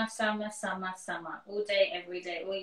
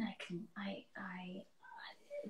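flustered whisper; 3 semitones lower, 18 dB quieter, much slower than usual. Real, not acted. Flustered when asked to speak Hindi.

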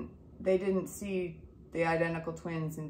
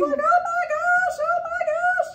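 much higher and louder, slow stunned squeal; thrilled squeal; 9 dB louder than usual. Retold or imitated. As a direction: squeal it like a delighted grandma. Mom hears she's having triplets.

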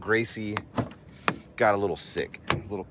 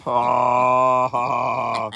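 normal talking voice

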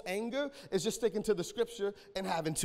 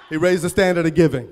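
laughing; 12 dB louder, faster than usual. Real, not acted. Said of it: "Every time my voice crack".

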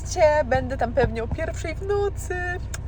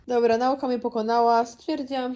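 ordinary talk

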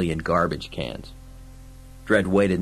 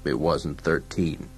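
low, slow, quieter denial; gruff lawman; 4 semitones lower, 5 dB quieter, slower than usual. Acted. Dredd denies Fergie's count, low and slow. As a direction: contradict him slowly and low.

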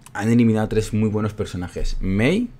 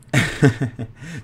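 higher-pitched burst of chuckles; amused laugh; 5 semitones higher than usual. Real, not acted. Streamer amused by the accent question, bubbling laugh. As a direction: laugh in a quick run of bursts.

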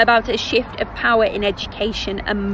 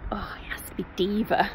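whisper; 3 semitones lower, 9 dB quieter than usual. Real, not acted. One-word preview, whispered aside.

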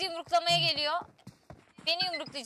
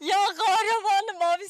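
usual speaking voice

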